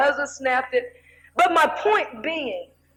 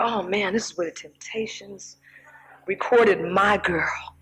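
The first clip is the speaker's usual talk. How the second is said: awed whisper; 6 semitones lower, 4 dB quieter than usual. Retold or imitated. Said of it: "I'm going totally off in there".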